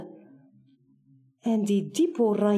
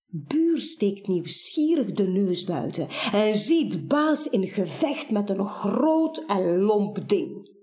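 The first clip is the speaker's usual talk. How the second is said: ordinary delivery, nothing marked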